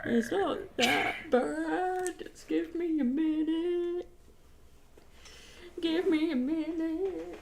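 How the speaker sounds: high, slow half-sung whine; mock whiny plea. To her husband on the call while she fetches water, a high mock-whiny sung plea. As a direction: drag it out, pleading for time like a hammed-up child.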